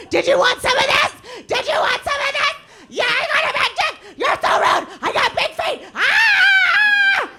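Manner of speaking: very high, very loud manic shouts ending in a scream; unhinged screaming. Retold or imitated. Squatting on the R train at the heckler, high manic shouting.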